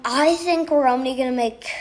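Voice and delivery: normal talking voice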